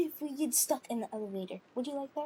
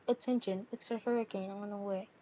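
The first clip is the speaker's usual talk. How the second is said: low, softer hurricane warning; mock announcement; 7 semitones lower, 4 dB quieter than usual. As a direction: announce it low, with less force. Repeats the mock warning, more softly.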